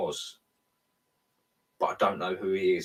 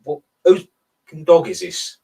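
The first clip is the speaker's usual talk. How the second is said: retold angry yell, louder and higher; angry outburst; 6 semitones higher, 9 dB louder than usual. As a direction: blurt it out in a mock rage at the dog's owner. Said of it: "and I'm like".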